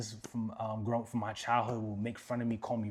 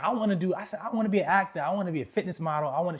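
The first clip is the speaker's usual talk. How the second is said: eager; 7 semitones higher, 6 dB louder, faster than usual. Retold or imitated. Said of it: "I was like".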